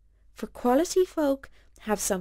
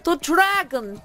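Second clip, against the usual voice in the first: high, loud cry of alarm; terrified shout from the crowd; panicked crowd shout; 9 semitones higher, 5 dB louder than usual. Acted. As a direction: scream it to warn the whole town. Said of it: "a crowd of people shouting and crying".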